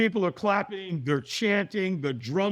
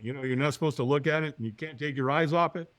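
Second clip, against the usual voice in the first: normal talking voice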